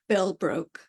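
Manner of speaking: ordinary delivery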